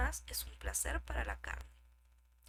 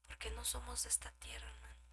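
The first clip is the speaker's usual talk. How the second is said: dramatic whisper; 11 dB quieter, faster than usual. Acted. Preacher whispering to the brothers. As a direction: drop to a hushed, breathless whisper.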